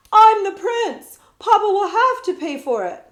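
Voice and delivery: stamp your foot as a bratty prince; indignant shout. A narrator's reading. Spoiled prince insists loudly.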